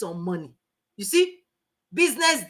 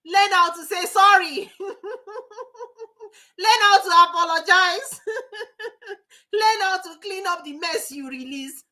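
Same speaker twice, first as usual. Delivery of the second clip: can't stop laughing while teaching; 9 semitones higher than usual. Real, not acted. To the audience, advising apologies, laughing through it. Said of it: "I'm laughing".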